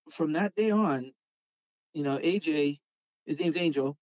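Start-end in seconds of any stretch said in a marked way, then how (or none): none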